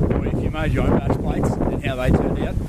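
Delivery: ordinary talk, nothing marked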